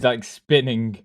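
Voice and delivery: normal talking voice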